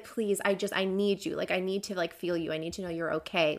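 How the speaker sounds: hushed lover's plea; soft desperate plea. Retold or imitated. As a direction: beg quietly and earnestly. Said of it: "she's like".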